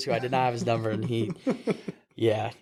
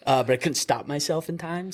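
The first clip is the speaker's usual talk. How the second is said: usual speaking voice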